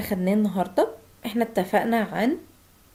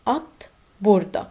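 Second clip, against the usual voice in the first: slow demo; much slower than usual. Shown as a demonstration.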